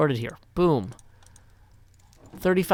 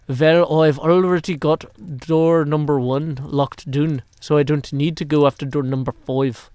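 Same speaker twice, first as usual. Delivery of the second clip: mock German accent, v for w, a bit louder; 4 dB louder than usual. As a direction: imitate Doc M in a put-on German accent. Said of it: "except with a German accent".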